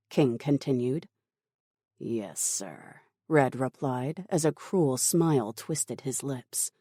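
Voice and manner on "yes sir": low, soft compliance; sinister henchman. Acted. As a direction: draw it out low with a cruel smile. Red accepts order with cruel smile.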